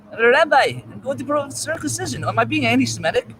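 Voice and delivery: mocking high voice. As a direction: ham it up like a mocking heckler. Mocks the joke in reprise.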